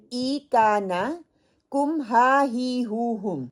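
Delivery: each pronoun ending spelled out slowly; slow, drawn-out drill chant for learners; slow recitation. Shown as a demonstration. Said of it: "یہ چھ حرف ہیں جو آپ نے لرن کرنے ہیں".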